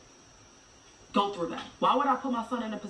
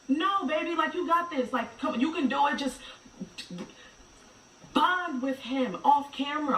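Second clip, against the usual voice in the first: higher, coaxing reassurance; encouraging; 6 semitones higher than usual. Retold or imitated. Mother retelling how she encouraged her friend, reassuring. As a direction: cheer her on gently. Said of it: "And I'd be like".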